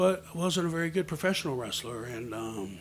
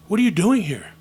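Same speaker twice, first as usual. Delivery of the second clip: loud, raised-pitch challenge; confronting a kid; 5 semitones higher, 10 dB louder than usual. Retold or imitated. Man finds boy backstage, confronting him loudly. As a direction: demand to know why he's there.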